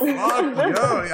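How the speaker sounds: high, triumphant echo; excited cheer. Real, not acted. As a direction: cheer back with her. Echoing the milestone cheer.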